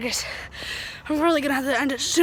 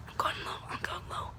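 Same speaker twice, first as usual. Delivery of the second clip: whispering; 17 dB quieter than usual. Real, not acted. Sneaking up during a game.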